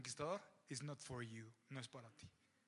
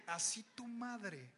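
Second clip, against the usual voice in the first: slow, weighty "Así tu madre"; solemn prophet line; slower than usual. Retold or imitated. Samuel pronounces sentence on Agag, slow and grave. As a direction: drag out "Así tu madre" slowly. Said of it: "Y Samuel le dice".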